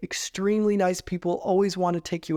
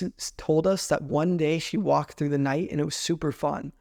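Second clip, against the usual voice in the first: ordinary talk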